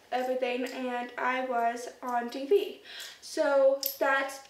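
normal talking voice

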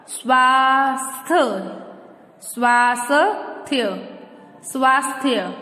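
"स्वास्थ्य" dragged out as "swaa-sa-thya"; wrong pronunciation. Shown as a demonstration. Teacher showing how we don't say it, mispronounced. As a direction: split "स्वास्थ्य" slowly, an extra vowel after s. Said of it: "ऐसे तो नहीं बोलते हम".

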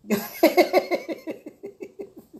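a burst of high laughter; high bright laugh. Real, not acted. Laughing with Loli about her happy years apart. Laughs after joyful remark.

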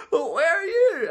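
very high, hammed-up wailing question; tearful wife. Crying wife asking back over the phone, mock tearful wail. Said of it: "I understand that cry anywhere".

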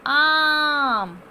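आम with a long loud आ held out; long vowel demo. Shown as a demonstration. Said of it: "अ के पीछे आ की मात्रा".

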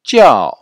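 jiao high and sharply falling, slow. Shown as a demonstration. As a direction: model jiao with a strong fourth tone. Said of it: "Répétez". (jiao au quatrième ton, appuyé et descendant)

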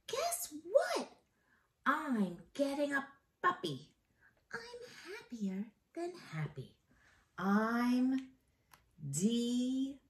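put-on bright, excited kid voice; happy little girl sharing news; delighted child. A narrator's reading. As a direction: burst out with the puppy news. Reads delighted girl's page.

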